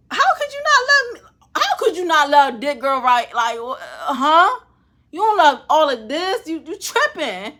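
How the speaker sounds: high, loud, incredulous sass; hammed-up disbelief, voice up high; mock indignant plea. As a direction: act out being offended they don't love you back. Imagined plea to unloving crush.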